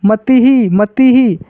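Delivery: "मति ही" with a clear closing hee; Sanskrit visarga pronunciation. Shown as a demonstration. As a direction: drill "मति ही", aspirating the final syllable. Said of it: "इसको क्या पढ़ेंगे संस्कृत में". ("मति ही" में विसर्ग को ही बोलें)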